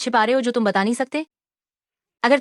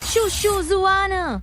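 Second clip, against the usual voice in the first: high-pitched, pleading call; desperate cry; 7 semitones higher, much slower than usual. Acted. Betrayed woman appealing to the woman she took as mother, desperate cry.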